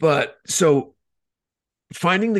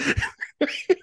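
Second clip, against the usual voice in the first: high laugh. Real, not acted. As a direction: burst of high-pitched laughter. Laughing at own story.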